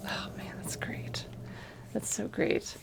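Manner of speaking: soft whispered praise. Real, not acted. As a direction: breathe it almost silently. Murmured aside.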